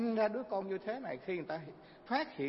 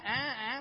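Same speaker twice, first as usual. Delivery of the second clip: mock anguished cry; 6 semitones higher than usual. Monk acts old man fainting.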